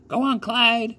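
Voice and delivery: put-on high old lady shooing the birds; old country woman. A narrator's reading. Old lady urges her scarecrow to shoo the crows, high and old. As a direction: urge him on like a fussy granny. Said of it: "said the woman".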